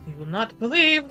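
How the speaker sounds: normal talking voice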